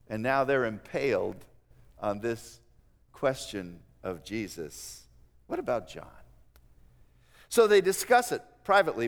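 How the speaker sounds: ordinary delivery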